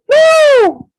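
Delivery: a mother's scream at the worst news; ear-piercing scream. Acted. Mother screams at the news of her child, piercing. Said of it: "there was an ear-piercing".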